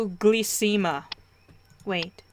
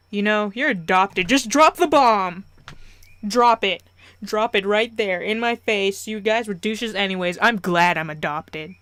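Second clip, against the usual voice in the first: loud, hammed-up rant that peaks early; loud comic indignation; mock outrage; 7 dB louder than usual. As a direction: burst out loudly, then keep hammering it. Comic outburst over being adopted.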